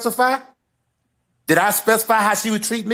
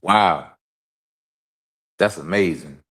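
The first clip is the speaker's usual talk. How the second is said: much lower, flat deadpan sarcasm; sarcastic wow; 14 semitones lower, slower than usual. Real, not acted. Co-host answering the liar charge with low deadpan sarcasm. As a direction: drop the voice and mock-marvel. After being called a liar.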